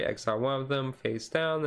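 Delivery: ordinary talk, nothing marked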